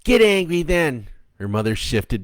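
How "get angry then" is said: high, loud dare; defiant girl. Acted. Stephanie daring the mother thing to get angry. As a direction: throw the dare back at her.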